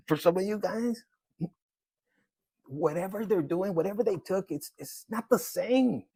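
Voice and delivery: ordinary delivery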